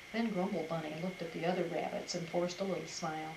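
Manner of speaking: plain reading voice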